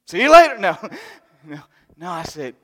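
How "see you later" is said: high, loud breezy goodbye; mock brush-off. Preacher joking about leaving the old man behind. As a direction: toss it off cheerily, joking. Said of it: "And I went".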